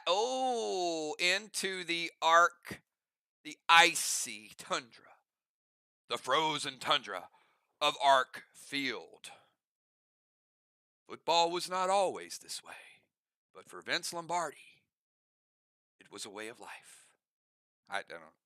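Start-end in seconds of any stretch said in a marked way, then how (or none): 0.0-9.3 deep dramatic sports narrator; slow, hushed epic narration; NFL Films narrator. Acted. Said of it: "trying to do one of those N F L film things". 11.1-12.7 whispered epic sports narration; hushed, dramatic documentary voice; NFL Films narrator. Acted. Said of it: "trying to do one of those N F L film things". 13.5-17.0 very deep, soft dramatic narrator; low hushed documentary voice; NFL Films narrator. Acted. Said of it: "trying to do one of those N F L film things".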